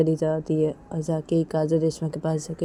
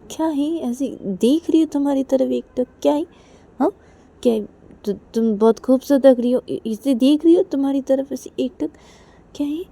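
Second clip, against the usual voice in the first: put-on higher, louder playful girl voice; higher playful friend admiring her; Kajal's voice; 9 semitones higher, 6 dB louder than usual. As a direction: tease your friend, high and bright. Kajal teasing Reshma.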